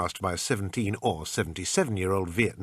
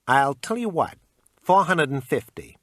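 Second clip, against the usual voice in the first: pawn shop salesman; 6 semitones higher, 6 dB louder than usual. Acted. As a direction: sell it like a pushy clerk. Shopkeeper haggling.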